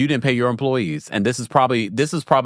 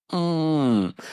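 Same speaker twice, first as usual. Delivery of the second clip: ordinary delivery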